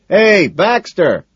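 loud, slow, high call. A narrator's reading. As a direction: call out across the club. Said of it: "calls out".

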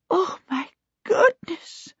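high, slow, shocked gasp. A narrator's reading. Missus Baker shaken by the kobold's visit, gasping. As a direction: gasp it out in fright. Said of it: "Missus Baker gasped".

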